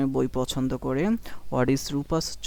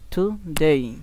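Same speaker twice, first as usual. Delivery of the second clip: Today split, with a swelling ডে; short টু, then punch ডে in today; emphatic final syllable on today; stress on second syllable; 3 dB louder, much slower than usual. Shown as a demonstration. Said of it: "এটা একটু জোরে বলতে হবে".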